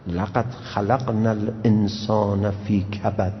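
slow, measured Quranic recitation. Acted. Preacher reciting the verse to his listeners, slow and solemn. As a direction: intone the verse slowly and reverently.

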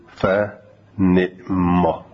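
فنعما stretched very slowly; drawn-out recitation. Shown as a demonstration. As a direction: recite فنعما slowly, both kasras clear. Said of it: "نعما دو تو کسره". (فنعما کشیده و آهسته)

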